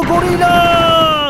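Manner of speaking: long, slow, stretched-out cry; mock battle cry. Player striking a gorilla, drawn-out mock battle cry. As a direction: hold the word like an attack move.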